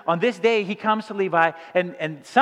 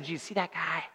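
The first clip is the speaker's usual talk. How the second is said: low, soft, hushed grumble about the taxman; hushed complaint; 8 semitones lower, 9 dB quieter than usual. Retold or imitated. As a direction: lean in and mutter it low to Jesus. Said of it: "it's likely that they said".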